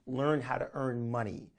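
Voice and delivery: usual speaking voice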